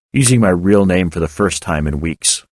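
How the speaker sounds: normal talking voice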